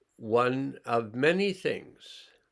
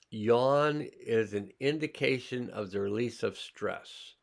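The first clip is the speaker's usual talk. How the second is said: ordinary delivery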